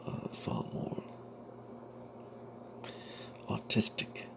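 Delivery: plain reading voice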